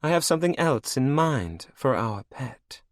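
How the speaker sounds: high, cold and mocking; villainess queen. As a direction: purr it over your captive like a cruel queen.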